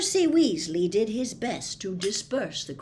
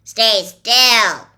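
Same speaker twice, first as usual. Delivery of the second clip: loud gruff dwarf grunt; gruff grunting command; grunted order; 3 semitones higher, 17 dB louder than usual. A narrator's reading. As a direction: bark it at him, rough and loud. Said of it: "grunted the dwarf".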